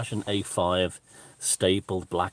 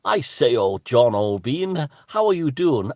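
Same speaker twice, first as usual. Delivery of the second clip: high, loud, drawn-out English caricature; 5 semitones higher, 7 dB louder, slower than usual. Retold or imitated. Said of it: "there's the voices of".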